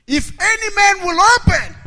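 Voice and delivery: very high, loud pulpit shout; preacher's soaring shout; shouted scripture. Real, not acted. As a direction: proclaim it at full force. Preacher shouts scripture at full force.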